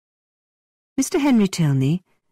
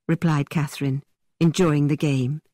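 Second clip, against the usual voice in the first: plain reading voice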